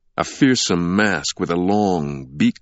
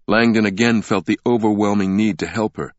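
ordinary delivery, nothing marked

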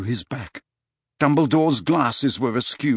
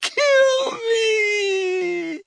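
high, drawn-out tortured scream; long wailing shriek; agonized yell. A narrator's reading. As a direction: cry out for death in agony. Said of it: "than he yelled".

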